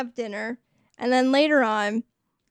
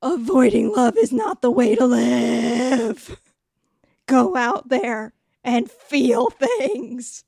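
loud stage whisper, then slow and deliberate; hammed-up wise mother holding her face; mock-solemn motherly pep talk; earnest motherly advice; 5 dB louder, slower than usual. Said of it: "holds her face and is like".

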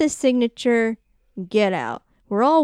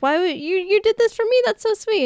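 raised, gushing pitch; high sweet voice; 10 semitones higher than usual. Retold or imitated. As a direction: gush it up high like a touched sweetheart. Victor voiced in retelling.

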